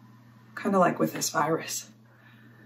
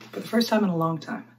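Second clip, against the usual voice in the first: ordinary talk, nothing marked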